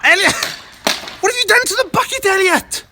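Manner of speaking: high loud fast yell; shouting. Real, not acted. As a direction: call him out loudly. Yelling at Elliot.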